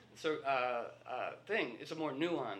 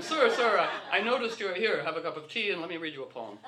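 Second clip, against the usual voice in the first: higher, louder laughing "sir, sir"; mock polite cop; 3 semitones higher, 7 dB louder than usual. A gentle officer offering a man tea, laughing. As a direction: play a sweet, giggling officer.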